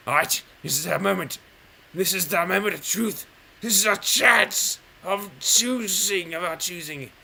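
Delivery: rally yourself like a trailer voice; hyped up. Real, not acted. Pumping himself up, moment of truth.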